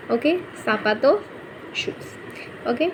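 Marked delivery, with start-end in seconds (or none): 1.8-2.7 whisper. Real, not acted. Aside translation.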